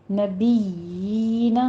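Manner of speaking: نبیین with the یا held two counts, lips flat; recited نبیین with a long یا; long yaa. Shown as a demonstration. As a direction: draw out the یا in نبیین. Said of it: "یینا کہنا ہے،".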